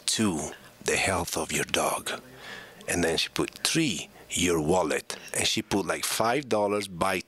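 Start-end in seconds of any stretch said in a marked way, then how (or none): 0.0-2.2 low, deliberate mother voice with long pauses; mother's letter voice. Retold or imitated. Son reading mother's reasons to quit, low measured voice. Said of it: "she was doing the voice like this". 3.6-5.2 low, slow mother reading her list; mother's letter voice. Retold or imitated. Son reading mother's last reason, low and slow. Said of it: "she was doing the voice like this".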